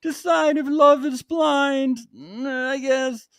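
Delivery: high, slow, hesitant priest voice; unsure priest imitation. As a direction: drag it out like a doubtful priest. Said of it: "even he doesn't sound sure about it".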